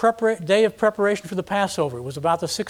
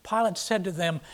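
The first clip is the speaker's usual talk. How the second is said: ordinary talk, nothing marked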